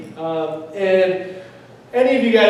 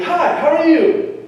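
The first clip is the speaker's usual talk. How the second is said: fake excitement; 9 semitones higher, slower than usual. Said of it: "I'm going to still act excited".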